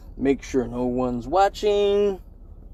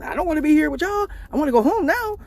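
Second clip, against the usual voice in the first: put-on whiny girlfriend; 12 semitones higher than usual. Girlfriend answers lady cop again.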